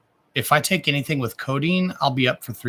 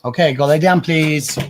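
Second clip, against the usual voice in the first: louder order to the pets; real command; 3 semitones higher, 5 dB louder than usual. Real, not acted. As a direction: call out a polite but firm order.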